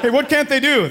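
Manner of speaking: ordinary talk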